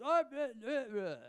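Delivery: high, loud slurred gibberish. Retold or imitated. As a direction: mumble nonsense like a wasted rocker. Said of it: "We can't understand a word he says".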